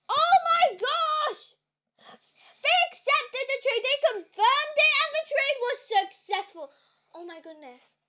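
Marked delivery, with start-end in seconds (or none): 0.1-6.7 loud, high-pitched real shriek of joy; thrilled outburst after nervous waiting; ecstatic shouting. Real, not acted. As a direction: shout the news in overjoyed disbelief. Trade accepted, real thrilled outburst.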